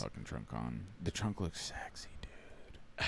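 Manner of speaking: hushed, breathy aside; whispering. Real, not acted. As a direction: whisper it like a secret. Teasing aside about the trunk.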